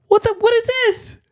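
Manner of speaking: very high, squeaky indignation; outraged disbelief. Retold or imitated. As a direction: complain about a tiny mail-order tree. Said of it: "this thing was like".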